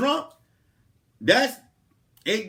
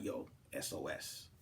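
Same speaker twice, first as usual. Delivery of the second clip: secret whisper, much softer; 18 dB quieter than usual. Retold or imitated. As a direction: hush it like a secret call. Secretly texting his friend.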